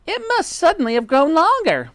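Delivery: high, loud wife in alarm; shrill-tempered wife, alarmed. Acted. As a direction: cry it out high and incredulous. Voices Mrs Twit in dialogue.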